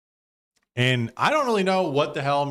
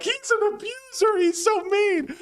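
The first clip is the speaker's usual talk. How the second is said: much higher, whiny mock voice of the critics; mocking complainers. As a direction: parody the overreacting crowd, pitched way up. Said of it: "people were like".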